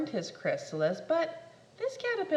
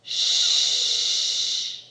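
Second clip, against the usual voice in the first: loud shush; 8 dB louder than usual. Acted.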